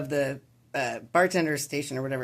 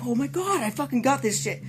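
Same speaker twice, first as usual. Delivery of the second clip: much higher, thrilled; can't believe he pulled it off; elated disbelief; 8 semitones higher than usual. Retold or imitated. Guy celebrating his knockout punch, elated.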